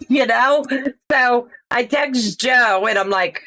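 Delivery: normal talking voice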